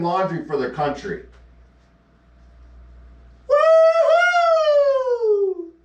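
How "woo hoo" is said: shrill mock whoop, much higher and louder; sarcastic cheer. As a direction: fake celebration whoop.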